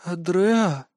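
a hint of a slow, lifeless drawn-out question; mechanical doll voice. A narrator's reading. Entranced girl answering the kneeling hero, lightly mechanical. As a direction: drag the name out as if you forgot how to speak. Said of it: "Голос тоже был какой-то механический".

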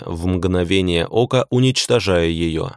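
plain reading voice

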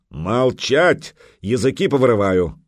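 barked viking threat. Acted. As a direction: snap it out like a viking. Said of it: "и рявкнул".

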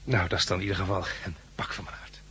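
a soft whispered aside of relief. Acted. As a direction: breathe it out in a whisper. (fluisterend van opluchting)

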